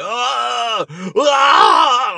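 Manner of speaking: loud pained cries, high; imitating a man doubled over in pain; hit hard crying out. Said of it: "He's like".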